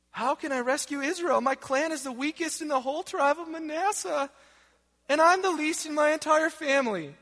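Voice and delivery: whiny voice. Acted. Gideon protesting to the Lord that he is too weak, whiny. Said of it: "this is in my best whiny voice".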